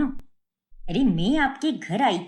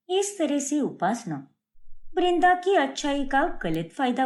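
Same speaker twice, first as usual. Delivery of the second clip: brighter, higher storyteller voice linking the scenes; story narration; 5 semitones higher than usual. A narrator's reading. Narrator explaining Upasana's taunts to listeners, higher storytelling voice. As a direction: tell it like a narrator guiding the listener.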